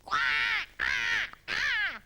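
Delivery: high, raucous parrot squawks; parrot squawking. A narrator's reading. Parrot interrupts Iggy's jumping, loud squawking. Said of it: "a loud squawking".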